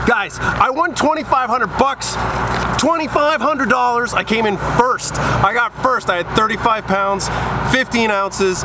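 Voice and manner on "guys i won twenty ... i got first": high, slow, stunned excitement; letting the big win sink in, thrilled; real elated disbelief; freaking out with joy. Real, not acted. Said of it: "guys, I'm freaking out".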